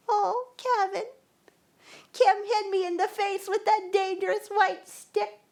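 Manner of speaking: high, put-on whimpering child, fake sobs on "Oh"; fake whiny crying. Neighbor girl tattling to the blind kid, fake whiny crying. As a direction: whine like a little girl pretending to be hurt. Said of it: "She started to cry in a fake, whiny way".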